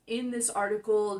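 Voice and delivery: normal talking voice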